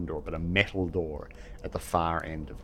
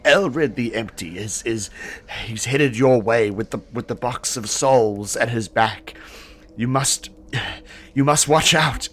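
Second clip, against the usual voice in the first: urgent, raised, breathless warning; ragged, breathless warning; 4 semitones higher, 6 dB louder than usual. Acted. Seer warning the party, breathless and urgent. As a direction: gasp out the warning as if hurt. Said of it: "her breath is kinda ragged".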